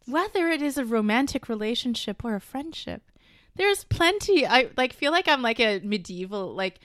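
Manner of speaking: mock medieval English accent; playing a scamming medieval fortune teller. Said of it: "I'm confused by the accent that you've taken on".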